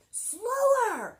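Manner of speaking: very high, very loud plea; panicked shout. Acted. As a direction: shout at the driver to slow down. Jack pleads as buggy speeds.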